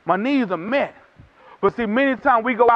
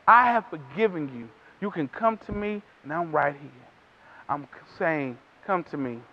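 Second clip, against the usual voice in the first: much softer and lower, gently inviting; tender voice of God; 8 semitones lower, 8 dB quieter than usual. Acted. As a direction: speak as a loving father calling his child home, hushed. God speaking tenderly to sinners.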